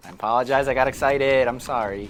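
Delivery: loud, emphatic apology. Real, not acted. Player who just shot somebody by accident, loud apology. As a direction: apologise loudly at an even pitch.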